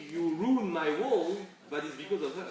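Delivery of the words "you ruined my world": slow, drawn-out, emphatic quote. Retold or imitated. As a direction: stretch each word out slowly. Quoting wife's complaint.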